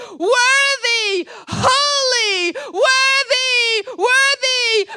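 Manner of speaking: high, loud, full-force cries of praise; shouted worship. Acted. Preacher voices the worship in Stephen's heart, ecstatic shouting. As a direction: hurl each word of praise out at full voice. Acts out worship rising from stones.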